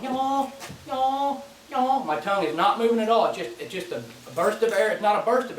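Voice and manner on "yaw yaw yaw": "Yaw" drawn out higher, like a yawning goose moan; moan call sound. Shown as a demonstration. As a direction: voice the moan as a high open yawn. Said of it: "it's like I'm yawning with the, uh, with the moan".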